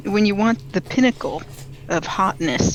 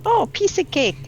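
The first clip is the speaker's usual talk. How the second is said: higher-pitched, cocky little quote; breezy overconfidence; 11 semitones higher, 3 dB louder than usual. Retold or imitated. Player retelling her reaction before the match, breezy. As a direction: toss it off high and carefree.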